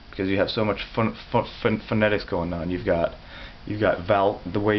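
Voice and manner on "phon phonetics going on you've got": usual speaking voice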